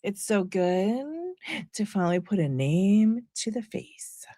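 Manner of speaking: slow, quiet, pointed mock delivery; mocking imitation. Imitating Erica greeting the girl, slow and pointed. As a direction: drag it out like a veiled threat. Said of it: "with how she says that part".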